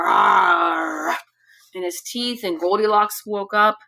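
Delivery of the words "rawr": low storybook growl; big bear growl. Acted. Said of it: "Daddy Bear gave out a big growl".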